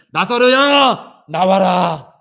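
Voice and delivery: loud, slow commanding shout into the tomb; calling out. Acted. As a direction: summon the dead man out. Jesus calls Lazarus from tomb. (크고 느리게 외치듯)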